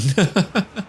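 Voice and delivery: short high giggle; a quick delighted giggle; high laugh. Real, not acted. Player giggling as the enemy general goes down.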